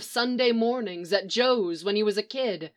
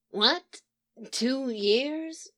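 a mild startled question, slightly higher; surprised exclaim; 4 semitones higher, 4 dB quieter, much slower than usual. Acted. Leonard learning he was gone two years, mild surprised exclaim. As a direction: react with understated shock. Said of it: "Leonard exclaimed".

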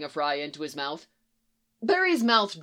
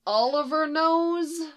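high, drawn-out whine; plaintive, stretched high question; whining; 9 semitones higher, slower than usual. A narrator's reading. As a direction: whine it slowly in dismay. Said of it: "Barry whined".

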